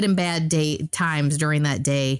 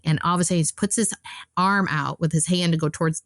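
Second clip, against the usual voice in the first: ordinary delivery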